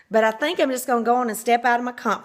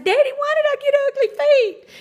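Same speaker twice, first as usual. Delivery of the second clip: put-on much higher, louder childlike whine; little girl voice; 5 dB louder than usual. Little girl asking her daddy, high childlike voice. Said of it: "But I said".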